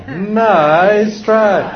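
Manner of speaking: high, drawn-out singsong "nice try"; cheerfully unfooled parent, stretched out; big-grin comeback. Shown as a demonstration. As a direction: sing it through a big grin. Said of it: "What's the answer?".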